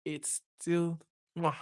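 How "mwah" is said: loud smacking kiss sound; chef's kiss. Real, not acted. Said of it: "chef's kiss".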